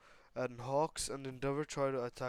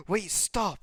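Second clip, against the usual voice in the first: high, loud interjection; urgent shout; 7 semitones higher, 7 dB louder than usual. Acted. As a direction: call a halt to a fight. Nezu interrupts the fight.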